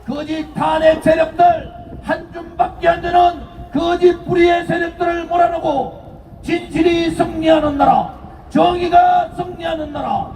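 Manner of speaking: shouted rally cry, building up; roaring rallying speech. Acted. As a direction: rouse the crowd to chant back. Rally speech, leading a chant.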